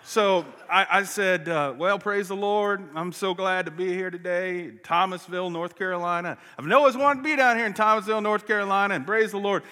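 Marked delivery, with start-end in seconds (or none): 1.8-4.7 put-on Southern accent. Visiting preacher greets a church down South, in a Southern accent. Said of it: "I started talking Southern". 4.8-6.4 put-on Southern accent. Visiting preacher names the town he is in, in a Southern accent. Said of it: "I started talking Southern". 6.6-9.6 loud, high, fast Southern gushing; Southern accent. Retold or imitated. Visiting preacher gushes to a Southern church, in a Southern accent. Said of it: "I started talking Southern".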